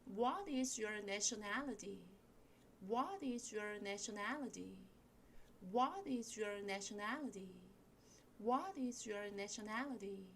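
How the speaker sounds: slow practice model, punching "What" and "nationality"; slow, measured drill with strong "What" and "nationality"; stress on WHAT and NATIONALITY. Shown as a demonstration. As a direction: lean on "What", ease through "is your", stress "nationality". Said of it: "세게 읽으시는 단어는 what 하고요".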